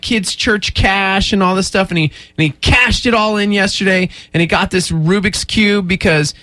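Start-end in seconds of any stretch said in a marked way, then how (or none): none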